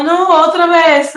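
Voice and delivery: a hammed-up groan of dread at starting over; dismayed groan. Back home from London, dreading starting over, slow loud despair. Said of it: "era como".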